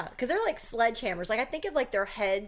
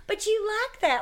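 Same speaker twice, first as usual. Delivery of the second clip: mimicking family member; 13 semitones higher, 4 dB louder than usual. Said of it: "they're like".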